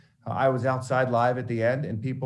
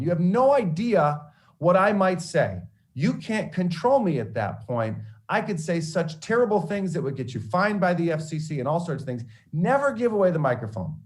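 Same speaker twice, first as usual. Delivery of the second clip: stern mentor lecture; 7 semitones higher than usual. Retold or imitated. Mentor lecturing after pausing tape.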